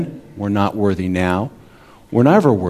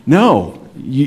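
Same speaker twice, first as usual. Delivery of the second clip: ordinary talk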